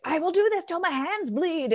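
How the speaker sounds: high, put-on fierce resolve; mock determination. She mimics herself gardening, in mock grim resolve. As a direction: ham up her own stubborn drive. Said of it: "And I'll be like".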